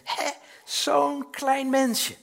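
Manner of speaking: high, slow, laughing wonder; amazed. Retold or imitated. Grandfather marvelling at an ultrasound, slow laughing amazement. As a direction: marvel at the tiny baby on the scan. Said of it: "En dan ben je helemaal verbaasd".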